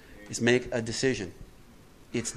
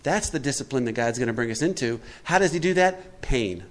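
ordinary talk

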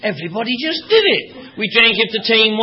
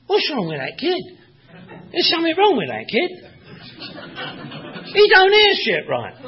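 mocking grown-ups; 4 semitones higher than usual. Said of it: "they'd say".

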